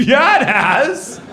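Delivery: high, slow, drawn-out fake agreement; mock-friendly stretched reply; playing along. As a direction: agree as if you know him. Playing along with unknown man.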